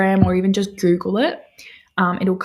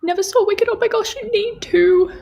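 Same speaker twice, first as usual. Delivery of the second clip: high, louder outraged surprise; amazed reply to a viewer who never saw Wicked; shocked disbelief; 13 semitones higher, 5 dB louder than usual. Real, not acted. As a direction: gasp at them in disbelief. Reacting to viewer never seeing Wicked.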